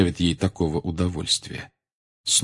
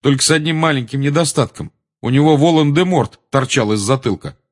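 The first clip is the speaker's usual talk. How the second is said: higher, defiant raised voice; loud; 6 semitones higher, 5 dB louder than usual. A narrator's reading. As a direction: carry on boldly for all to hear. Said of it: "громко сказал Гарри". (громко, дерзко, повышенным тоном)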